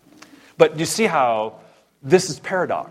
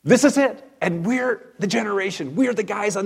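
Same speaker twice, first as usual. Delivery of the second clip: thrilled disciples; 8 semitones higher than usual. Retold or imitated. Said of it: "It's like".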